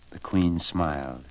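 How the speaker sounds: ordinary delivery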